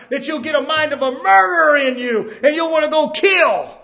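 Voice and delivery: loud, high driving sermon shout; fiery preaching. Acted. Preacher warning the flock about Satan, shouting. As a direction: hammer each line from the pulpit.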